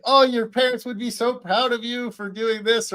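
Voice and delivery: touch of gushing parental praise; dad mode. Retold or imitated. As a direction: imitate a proud dad, lightly. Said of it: "he goes into straight dad mode".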